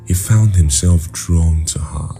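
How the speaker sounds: ordinary delivery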